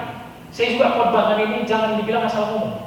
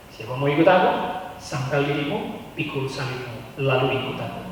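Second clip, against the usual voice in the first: voice of Jesus; 7 semitones lower, 5 dB quieter than usual. Retold or imitated. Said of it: "yang Yesus katanya".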